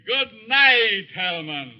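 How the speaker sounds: mocking farewell. Professor taunts Herman with a mocking good night. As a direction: toss the goodbye off like a cruel joke.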